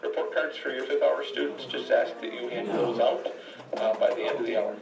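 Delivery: announcement over the PA speaker; tinny loudspeaker announcement; school intercom. Real, not acted.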